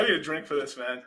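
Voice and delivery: ordinary talk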